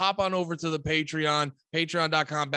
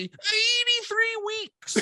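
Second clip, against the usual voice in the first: very high, drawn-out mock cry; mimicking a show title; much slower than usual. Host naming the podcast to co-host, high mock announcement.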